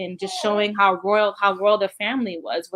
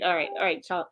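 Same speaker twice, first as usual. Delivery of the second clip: normal talking voice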